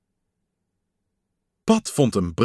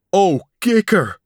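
higher, louder and slow relieved sigh of joy; 8 semitones higher, 6 dB louder than usual. Acted. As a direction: melt with relief. Pat relieved to see friend.

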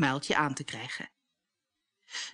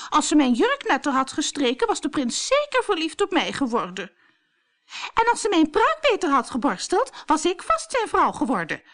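high, louder snapping rant; a much higher, louder angry snap; 10 semitones higher, 4 dB louder than usual. A narrator's reading. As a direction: snap out the blame, spiteful. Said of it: "snauwde Truida".

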